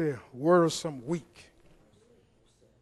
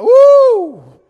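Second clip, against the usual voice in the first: much higher, loud joyful whoop; excited whoop; 21 dB louder than usual. Retold or imitated. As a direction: whoop like you just won the lottery. Winner hears of million prize.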